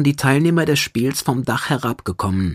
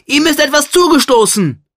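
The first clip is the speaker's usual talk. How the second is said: high, loud alarmed call; hoarse cry; 14 semitones higher, 6 dB louder than usual. A narrator's reading. Fadon calls to Katsykos that Malagan is missing, hoarse cry. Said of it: "rief er heiser".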